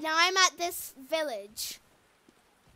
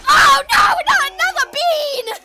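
dismayed shout; 7 semitones higher, 9 dB louder, slower than usual. Real, not acted. Dreading another jelly bean.

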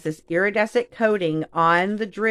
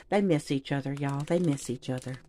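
hushed, tender aside about beads missing each other; soft pretend pity; 5 semitones lower, 9 dB quieter than usual. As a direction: drop to a soft coo.

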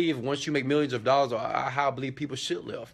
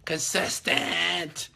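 CONSISTENT stretched out very slowly, higher in pitch; emphatic repeat; 4 semitones higher, much slower than usual. Real, not acted. Coach driving the key rule home to viewers, CONSISTENT slowly stretched. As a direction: draw out every syllable of CONSISTENT.

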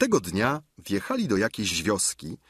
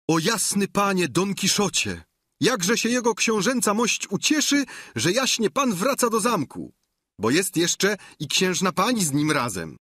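an eager, higher, fawning servant; lackey; 9 semitones higher, 3 dB louder than usual. A narrator's reading. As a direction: gush like a delighted servant. Lackey greets Don Quixote eagerly.

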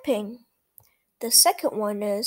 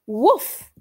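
puppy barks; 6 dB louder than usual. Acted. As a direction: bark like an eager little puppy. Voicing the puppy Biscuit.

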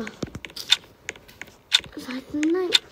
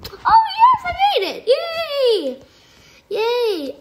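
triumphant shout; 11 semitones higher, slower than usual. Real, not acted. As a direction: scream with joy at surviving. Survives the round.

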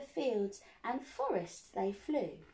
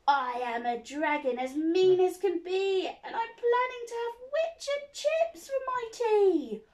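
much louder, higher menacing dragon; dragon roar; 9 semitones higher, 8 dB louder than usual. Acted. Dragon threatening the witch, louder roar. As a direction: growl it out greedily. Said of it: "a roar that was scary and loud".

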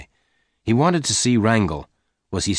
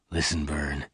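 calm Lassiter; 5 semitones lower, 8 dB quieter than usual. A narrator's reading. Lassiter calmly reasons with Venters.

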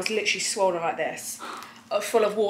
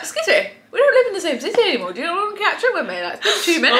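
high, fast indignant protest; mock outrage at the short call; 8 semitones higher, faster than usual. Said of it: "I'm like".

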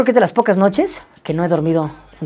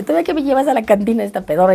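mock-angry girl, pitched up; higher, indignant complaint, imitating her; bien enojada; 7 semitones higher than usual. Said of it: "pues, bien enojada". (reclamo enojado imitado, voz más aguda)

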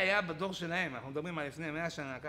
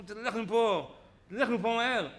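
loud, higher, irritated order; brusque dismissal; 4 semitones higher, 6 dB louder, slower than usual. Acted. Listeners chase off a rebuking preacher, brusque dismissal. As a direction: send him packing, loudly and impatiently.

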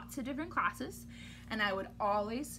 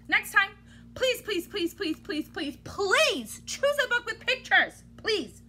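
higher, louder repeated begging; mock pleading; 7 semitones higher, 4 dB louder than usual. Reader begging the kids for a picture book, high. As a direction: beg dramatically.

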